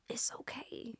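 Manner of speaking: voiceless whisper; soft whisper. Real, not acted. Actress softly telling herself it's okay. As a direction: breathe it out gently.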